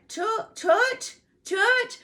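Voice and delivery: "church" said high and rising as "tut", without the R or ch; high childlike "tut, tut"; toddler mispronunciation. Retold or imitated. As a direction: imitate a toddler stumbling over "church". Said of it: "'cause he couldn't say his R's".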